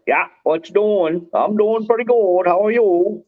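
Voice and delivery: high-pitched mock Maine accent; imitated Maine accent. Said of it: "Maine people do too".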